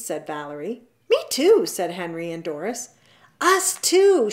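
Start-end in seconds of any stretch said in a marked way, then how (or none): 1.1-1.7 high, loud, excited; eager chiming in. A narrator's reading. Henry and Doris wanting to join in, eager shout. 3.4-4.3 high, loud call; eager shout. A narrator's reading. The Franks joining in with classmates, eager high shout. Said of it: "shouted the Franks".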